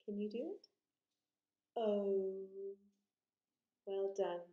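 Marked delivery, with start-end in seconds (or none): 1.8-2.5 O held long and loud; long vowel O. Shown as a demonstration. Teacher shows the circle shape, O stretched loudly. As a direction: push out a long O, lips closing to a small circle. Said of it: "it gets smaller as we go".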